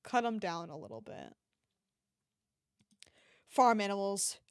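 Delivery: usual speaking voice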